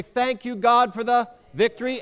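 high, convinced proclamation; like you believe it. Shown as a demonstration. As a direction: declare it with ringing conviction. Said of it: "let's say it like we believe it".

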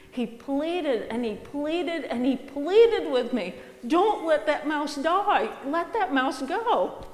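put-on little boy begging to save the mouse; pleading child.

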